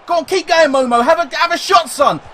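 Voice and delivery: high, loud cheering on; excited urging. Real, not acted. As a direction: egg the player on, yelling. Urging player to shoot.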